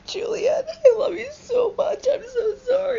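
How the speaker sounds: high, loud, hammed-up bawling; mock sobbing. Romeo over Juliet's body, exaggerated tearful wailing. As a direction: cry the words like a heartbroken kid. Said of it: "they cry".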